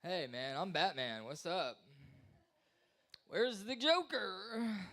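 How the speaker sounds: a hint of a normal chirpy Batman, slow at the end; lightly voiced casual Batman; cleared-up Batman. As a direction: show the cured voice, casual. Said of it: "his voice, like, totally changed".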